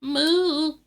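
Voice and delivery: high, loud, drawn-out moo; cow moo. Pretend. Streamer trying out a new accent, high loud cow moo. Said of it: "it's just my moo".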